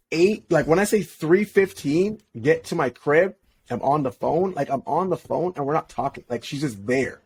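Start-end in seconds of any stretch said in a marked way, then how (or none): none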